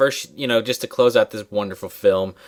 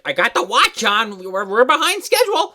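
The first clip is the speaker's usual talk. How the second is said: high, urgent put-on voice; harried aunt; 10 semitones higher, 4 dB louder than usual. Aunt barges in about the schedule, high harried mock voice. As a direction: burst in fretting, pitch climbing.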